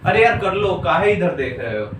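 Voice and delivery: ordinary delivery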